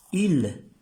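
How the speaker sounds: "Il" said loud and high, French vowel; French île. Shown as a demonstration. Teacher to class, loud model of "Il". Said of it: "se pronuncia il".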